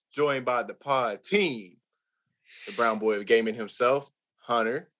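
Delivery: announcer-style sign-off; slow host outro, like closing the show; podcast outro. Acted.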